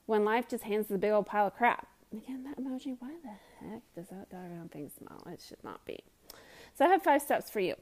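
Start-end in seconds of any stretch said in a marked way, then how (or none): 2.1-6.0 hushed, unvoiced grumble at the emoji; a whispered gripe to the listener; whispered aside. Real, not acted. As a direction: drop to a conspiratorial whisper. Mock complaint about smiling emoji.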